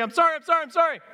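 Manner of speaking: put-on high, childlike pleading apology; pleading kids. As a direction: beg forgiveness like a guilty kid. Kneeling kids apologize to parent.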